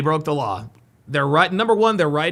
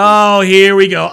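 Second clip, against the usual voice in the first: usual speaking voice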